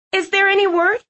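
high, anxious woman's voice; worried Twyla voice. Acted. Twyla again asking anxiously.

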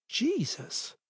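very soft, breathed oath; hushed gasp. Acted. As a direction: barely breathe it. Sees son eating raw meat.

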